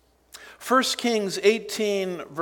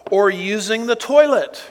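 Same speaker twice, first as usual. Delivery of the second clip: louder, higher sneering jab; louder sarcastic quip; 4 semitones higher, 6 dB louder than usual. Acted. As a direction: toss the insult with a smirk. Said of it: "Talk about sarcasm".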